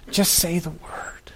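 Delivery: soft whispered begging; hushed, humble request; whispered plea. Acted. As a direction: breathe the plea out like a secret.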